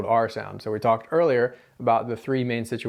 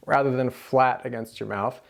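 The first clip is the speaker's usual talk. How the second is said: ordinary delivery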